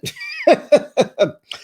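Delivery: squealing laugh, high and loud; high burst of laughter. Real, not acted. Vlogger laughs at her cousin's birthday texts, squealing. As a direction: let out a real high-pitched laugh.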